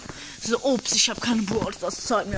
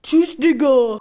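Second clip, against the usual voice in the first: loud goodbye; 3 semitones higher, 9 dB louder, slower than usual. Acted. Hangs up on the friend.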